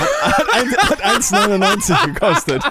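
high laughing reveal. Real, not acted. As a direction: crack up as you reveal it. Reveals cheap price. (lachend verraten)

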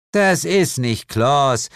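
high, slow, drawled demon mother; bored correction. A narrator's reading. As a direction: correct him wearily. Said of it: "korrigierte Lilith gelangweilt".

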